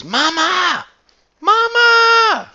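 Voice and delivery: very high, loud calling "Mama!"; old farmer hollering for his mother. Retold or imitated. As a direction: yell for mama across the house.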